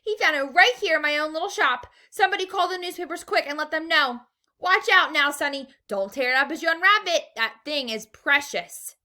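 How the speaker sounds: high, loud, urgent shopkeeper; yelling. A narrator's reading. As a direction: call everyone in, frantic with pride. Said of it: "he yelled".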